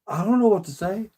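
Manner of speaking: high-pitched imitation of being at a loss; mock bewilderment. Imagined listener put on the spot by a plea, bewildered. Voicing the friend's baffled thought.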